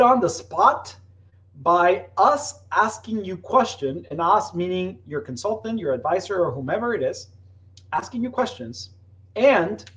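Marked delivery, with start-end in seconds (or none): none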